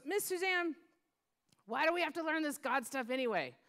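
hint of a child putting a question to a grown-up; little girl. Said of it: "this one little girl".

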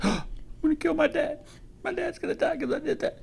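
put-on high, panicked little boy; scared kid voice. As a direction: voice a frightened child, high and breathless. Scared child's thoughts voiced.

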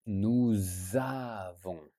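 nous avons with a Z linking to avons, slow; liaison nous avons. Shown as a demonstration. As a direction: say nous avons as "nouz-avons", slowly. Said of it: "if the next word starts with a vowel".

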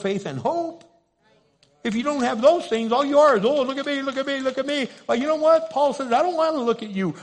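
loud, driving preacher cry; fiery preaching. Acted. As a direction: preach it out to the whole church. Preacher building to full force.

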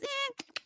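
high mocking copy of the cook's eh; mock the cook's squint-eh. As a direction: friend mocking the cook, high eh. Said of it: "she kept mocking the guy".

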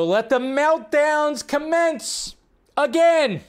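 slow, high, theatrical proclamation; mock grand announcement. Host to viewers about Musk buying Twitter, slow mock-dramatic proclamation. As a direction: announce the meltdowns like a doomsday herald.

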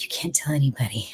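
hushed secret whisper. Retold or imitated. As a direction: whisper it like a confession. Retold secret told to coworkers.

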